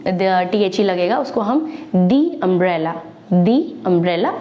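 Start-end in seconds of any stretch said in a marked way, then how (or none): 2.0-4.3 दी umbrella with a long "dee"; teacher's model: दी umbrella; the before vowel. Shown as a demonstration. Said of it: "इस तरह से उच्चारण करेंगे".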